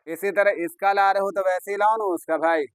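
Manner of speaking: ordinary talk, nothing marked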